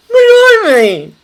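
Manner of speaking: high, loud startled blurt; astonished. Acted. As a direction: burst out in sheer surprise. Jessup shocked by footman news.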